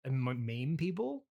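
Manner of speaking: ordinary talk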